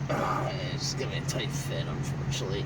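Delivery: whispering. Real, not acted. Straining on a tight fit.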